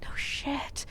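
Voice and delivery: whispered sarcastic aside; muttered aside. Retold or imitated. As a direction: say it under your breath, barely any voice. Sarcastic private reaction to the shouter.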